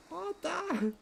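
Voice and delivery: a long, high call of the name. Acted. Andreas searches the village for Otto, high call. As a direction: call out as if he's far off.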